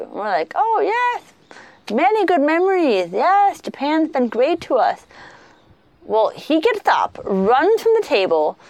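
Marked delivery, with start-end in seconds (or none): 0.5-5.0 much higher, hammed-up polite enthusiasm; gushing reply. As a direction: overdo the grateful guest. Gushing reply to host's question.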